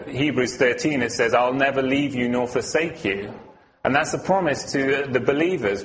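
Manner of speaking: normal talking voice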